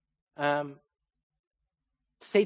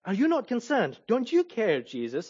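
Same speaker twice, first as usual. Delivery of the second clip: Martha's protest; 4 semitones higher than usual. Retold or imitated. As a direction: press Jesus, demanding he care. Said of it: "Notice how she asks".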